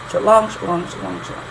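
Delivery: quick light "sha", weighted "long", fading away; short-long rhythm. Shown as a demonstration. Teacher showing the short-long foot, iambic rhythm. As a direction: run shalong as a pulse, stress on "long". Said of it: "it's the short long".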